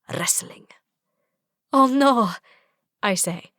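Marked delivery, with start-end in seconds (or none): 0.1-0.7 whispered playful threat. A narrator's reading. Teasing threat. 1.7-2.3 mock dismay. A narrator's reading. Mock dismay before tackle.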